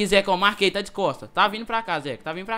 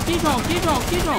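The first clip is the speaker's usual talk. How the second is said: loud, high call across; 10 semitones higher, 4 dB louder, faster than usual. Real, not acted. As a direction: shout to get his attention. Calling teammate over in game.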